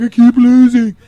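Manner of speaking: loud, high mocking whine; whiny contestant. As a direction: whine like a sore loser. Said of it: "I'm like".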